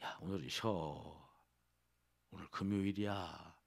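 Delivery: low, slow, soft coaxing; drawn-out, hushed tempter at the ear; tempting devil's voice. Acted. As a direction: lull them lazily into staying home. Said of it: "그 음성 들으셨어요?".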